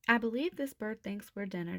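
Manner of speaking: usual speaking voice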